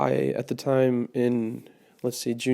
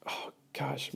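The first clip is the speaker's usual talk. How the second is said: whispered, overwhelmed; breathless whisper; 2 semitones higher, 11 dB quieter than usual. Retold or imitated. Overwhelmed, thanking youth pastor.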